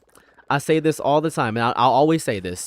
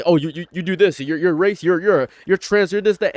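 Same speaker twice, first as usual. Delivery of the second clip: stammering, higher-pitched accusations; flustered accuser; 5 semitones higher, 4 dB louder than usual. Acted. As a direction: imitate someone grasping for insults. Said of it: "You're trying to say".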